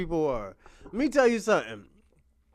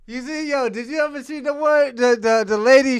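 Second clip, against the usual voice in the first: ordinary delivery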